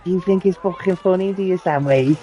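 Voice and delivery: hammed-up furious sergeant; angry sergeant. Sergeant catching a recruit laughing, mock angry. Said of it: "And he fucking".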